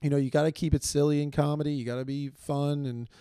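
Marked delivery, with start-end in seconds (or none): none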